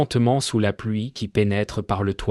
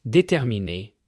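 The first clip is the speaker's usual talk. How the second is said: usual speaking voice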